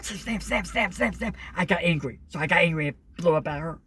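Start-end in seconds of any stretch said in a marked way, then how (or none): 0.0-1.3 imitating door slams. Sister slamming the door, imitated in sharp higher beats. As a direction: mimic a door banging over and over. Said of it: "She started slamming the door".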